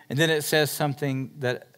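usual speaking voice

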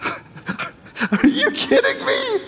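high, laughing, slow disbelief; laughing amazement. Real, not acted. Preacher stunned by Jesus's words, laughing in disbelief. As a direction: break into an astonished laugh.

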